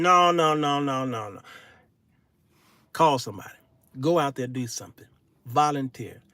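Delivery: ordinary talk, nothing marked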